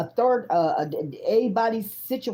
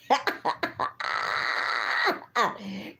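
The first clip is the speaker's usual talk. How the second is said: real burst of laughter; 3 semitones higher, 4 dB quieter than usual. Real, not acted. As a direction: laugh out hard at yourself. Laughs at her own story.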